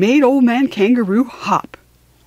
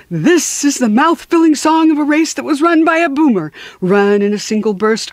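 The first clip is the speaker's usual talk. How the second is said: put-on old-time sportscaster; 3 semitones higher than usual. Reader calls the kangaroo race like a sportscaster. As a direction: announce it like an old radio race caller. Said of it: "like an old-time sportscaster".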